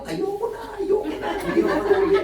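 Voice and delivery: a hammed-up high yell of a woman in labor; mock screaming. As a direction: shriek it, laughing, like a mother mid-labor. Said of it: "yung iba nga sumisigaw".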